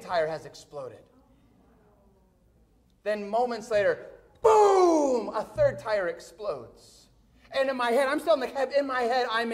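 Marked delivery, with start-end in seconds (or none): 4.4-5.3 loud, high, long falling boom; booming explosion imitation. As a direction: explode on the word. Imitates third tyre exploding.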